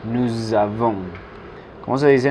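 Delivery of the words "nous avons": "Nous avons" said as nou-zavons; Z liaison. Shown as a demonstration. Teacher repeating nosotros tenemos in French, voiced Z liaison. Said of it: "Aquí tiene el sonido Z".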